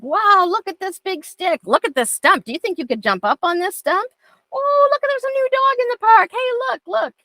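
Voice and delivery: high excited dog talk; excited pointing out. Shown as a demonstration. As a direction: sell the stick like it's thrilling. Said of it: "point out points of interest".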